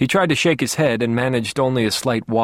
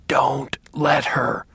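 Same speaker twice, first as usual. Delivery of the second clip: slow, hoarse whispered plea; hoarse but forceful; 3 semitones higher, much slower than usual. A narrator's reading. Sick father begs his son for protection, hoarse and forceful. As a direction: rasp each word out with effort. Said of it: "he said in a hoarse voice with surprising strength".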